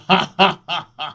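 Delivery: high sneering laugh; scornful laugh. Acted. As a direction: laugh at them with contempt. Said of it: "빈정거리며 말했다".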